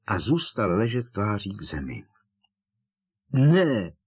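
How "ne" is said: loud cry of alarm; one horrified, forceful cry; shouted. A narrator's reading. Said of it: "vykřikl Harry". (zděšený výkřik)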